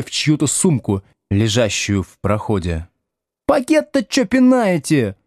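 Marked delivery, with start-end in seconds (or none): 3.5-5.2 high-pitched, spiteful squawk; indignant schoolgirl, voice shooting high; angry girl's snap. Acted. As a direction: snap at whoever kicked your bag. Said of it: "злобно рявкнула какая-то девица".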